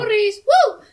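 high whooping cheer; mock cheer. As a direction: cheer it out, hammed-up. Cheering after furries joke.

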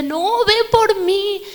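put-on high, slow begging like a child; slow whiny plea. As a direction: drag it out, pleading. Retold plea to father.

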